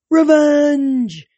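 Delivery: loud, high battle cry; shout. A narrator's reading. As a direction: chant it out like an eager mob. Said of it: "several shouted".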